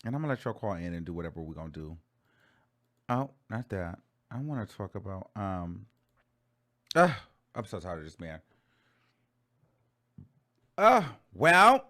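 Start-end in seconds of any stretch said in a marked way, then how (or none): 0.0-8.4 quiet. Real, not acted. Sudden drop in voice.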